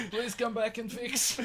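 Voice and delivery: high, loud plea through laughter; laughing plea. Acted. Game master as stoned smoker begging for help, laughing. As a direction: beg slowly while cracking up.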